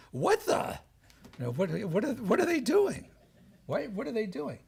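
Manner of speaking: high, rising incredulous questions; stunned disbelief. Retold or imitated. Speaker imitates the new pope reacting to his election, stunned. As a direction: pitch way up in baffled disbelief, retold.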